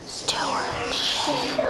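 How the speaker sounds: soft unvoiced whisper, slow. Shown as a demonstration. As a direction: whisper it slowly to your elbow. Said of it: "Whisper stewardship to your elbow".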